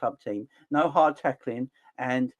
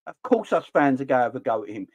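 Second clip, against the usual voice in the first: ordinary talk, nothing marked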